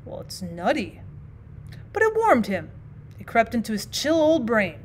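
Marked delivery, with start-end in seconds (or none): 0.0-1.0 soft and faint, much lower; faintly. A narrator's reading. Old man tastes the whiskey, faint and soft. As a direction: let it come out faint after the swallow. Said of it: "said faintly".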